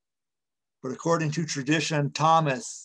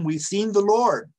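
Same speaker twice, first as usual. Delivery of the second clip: excited disciples; 6 semitones higher, 6 dB louder than usual. Acted. Disciples tell Charles the news.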